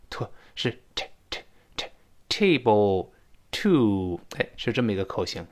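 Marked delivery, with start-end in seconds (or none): 0.1-3.1 t t t table spelled out very slowly and softly; teacher drills t, very slow and soft; very slow t demo. Shown as a demonstration. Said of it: "不是托".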